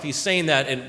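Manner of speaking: ordinary delivery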